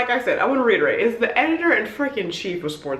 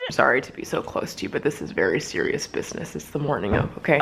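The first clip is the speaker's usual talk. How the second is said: low, confidential mock-grave voice; mock seriousness; 8 semitones lower, 4 dB quieter than usual. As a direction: drop low, as if deadly serious. Mock-serious close-up to camera.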